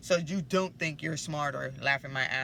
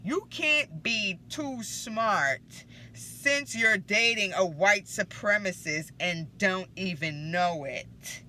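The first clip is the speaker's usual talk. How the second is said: very slow, higher, drawn-out "You can't be too smart"; dramatic punchline; 3 semitones higher, 5 dB louder, slower than usual. Acted. Rereading the headbanger line for waiting viewers, slow dramatic build. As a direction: stretch each word for the big reveal, then drive it home. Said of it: "This is the head banger you've been waiting for".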